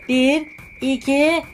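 ordinary delivery

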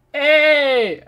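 high, loud sudden call; sharp loud hey; loud shout. Real, not acted. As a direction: shout to get attention. Calls out in game.

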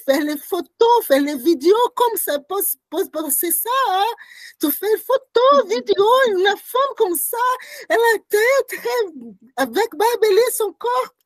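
rising into an upset cry over the gawkers; indignant outburst. Retold or imitated. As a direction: voice cracking with disbelief at people filming. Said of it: "Il dit".

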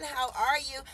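ordinary talk

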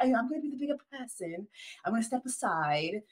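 soft mock London accent; American doing a decent English accent, quietly. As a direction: imitate a London woman in her British accent. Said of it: "English accents from Americans".

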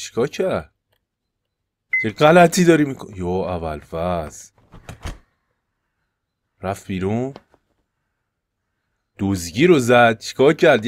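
ordinary talk

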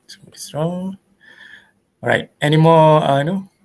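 ordinary delivery

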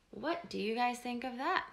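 usual speaking voice

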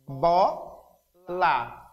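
Bola said carefully as bo-la, two sounds; teacher sounding out Bola syllable by syllable; demonstrating syllables. Shown as a demonstration. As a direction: model Bola for the class, syllables clear. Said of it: "dois sons são produzidos".

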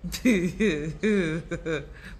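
drawn-out chuckle; giggle. Real, not acted. Coach laughing along with her amused viewers, a drawn-out giggle. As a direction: giggle helplessly for a moment.

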